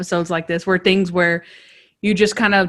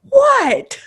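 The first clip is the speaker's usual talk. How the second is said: much higher, laughing mock shock; mock clown surprise; 4 dB louder than usual. Imitating the clown's innocent surprise at being arrested. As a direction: squeak it like a baffled clown.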